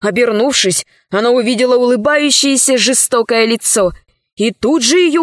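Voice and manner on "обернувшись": usual speaking voice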